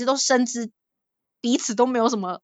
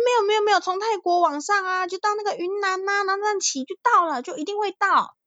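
put-on higher, insistent Taiwanese-accented elder; Taiwanese-accented Mandarin; 10 semitones higher than usual. Elder insisting you can ride via Yunnan, in a Taiwanese accent. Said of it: "那个讲台语那个腔调".

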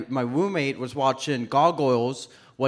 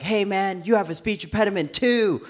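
higher, quick excited greeting; 5 semitones higher than usual. Acted. Comic runs up to stranger in a bar, excited. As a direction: greet a fellow sufferer eagerly. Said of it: "I got excited".

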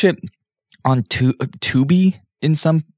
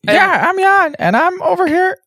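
fake Jan voice. Cohost fakes absent Jan's voice for a joke, high put-on voice. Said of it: "Jan, you don't sound like that".

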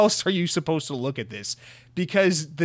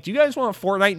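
higher, louder pushy pitch; mock eager salesman; 7 semitones higher, 5 dB louder than usual. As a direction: Ubisoft pushing a game on fans, mock eager. Mocking Ubisoft pitch to fans.

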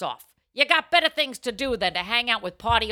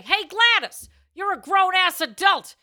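high, loud "Hey, Gladys"; loudly calling out a friend at dinner; 9 semitones higher, 5 dB louder than usual. Acted. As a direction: call her out loud across the table. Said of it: "you say".

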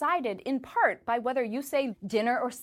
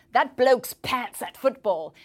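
slow, loud English insult; hammed-up English football fan; mock British accent; 7 dB louder, slower than usual. Example of a British insult, acted.

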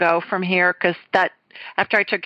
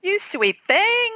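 tender aw; 13 semitones higher, slower than usual. Real, not acted. Host touched by caller's praise.

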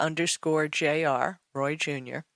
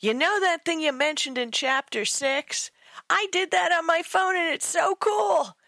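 excited editor; 10 semitones higher than usual. Retold or imitated. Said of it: "she says".

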